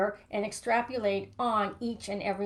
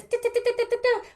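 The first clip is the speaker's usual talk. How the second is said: mock mind chatter; 14 semitones higher, 6 dB louder, much faster than usual. Mocking her own inner chatter.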